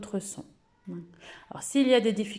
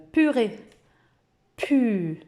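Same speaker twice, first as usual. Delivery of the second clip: purée high and loud, then pu; teacher giving an example word; 3 semitones higher, 6 dB louder, much slower than usual. Shown as a demonstration. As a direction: sing out purée. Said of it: "comme, euh".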